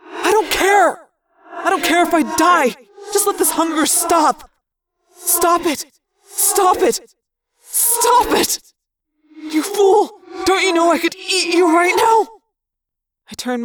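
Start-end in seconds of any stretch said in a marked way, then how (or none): 0.1-4.5 high, loud desperate cry; raised, high-pitched frantic protest; desperate outburst. Acted. Desperate, starving character argues back. 5.1-8.6 each cry higher, climbing to a scream; from high yell up to frantic shriek; escalating desperate shout. Acted. Desperate argument, repeated command escalates. 9.4-12.3 high, loud furious shouting; high-pitched, desperate yell; frantic threat. Acted. Hungry creature threatens its companion.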